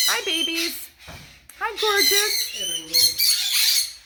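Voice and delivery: very slow, high cooing; drawn-out coo at the bird. Real, not acted. Cooing at birds.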